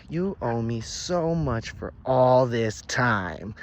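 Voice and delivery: loud, slow, hammered-out accusation; menacing demand. A narrator's reading. She tells him what he owes, loud drawn-out demand. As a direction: drag out the debt with relish.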